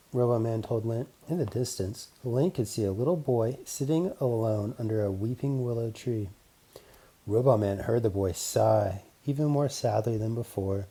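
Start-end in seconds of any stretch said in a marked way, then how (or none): none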